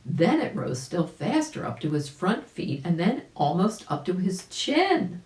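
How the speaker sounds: plain reading voice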